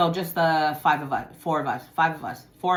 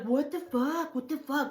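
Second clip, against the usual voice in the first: high, softened retold disbelief; stunned cousin; 8 semitones higher, 6 dB quieter than usual. Acts out cousin's stunned reaction.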